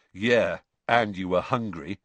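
usual speaking voice

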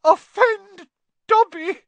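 high, loud, slow choked elf. A narrator's reading. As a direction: choke it out in a squeal, overcome. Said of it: "choked the elf".

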